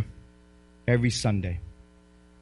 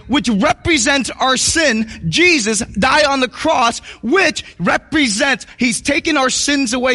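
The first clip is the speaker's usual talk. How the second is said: rising, ringing preacher's cadence, louder and higher; full-force preaching; 8 semitones higher, 4 dB louder, slower than usual. Acted. Pastor rallying his Easter congregation, full-force preaching. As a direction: drive it home to the congregation.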